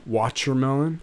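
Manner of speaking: WATCHERMELON with a ch in place of the t; imitated mispronunciation. Friend imitates how he says it, mock mispronunciation of Watchermelon. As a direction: say "Watchermelon" with a chuh in the middle. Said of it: "How does he say it?".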